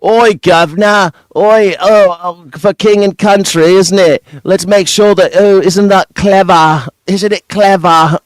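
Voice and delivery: mock a cheery Londoner calling out; mock London accent. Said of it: "He was born in London".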